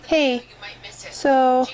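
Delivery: ordinary delivery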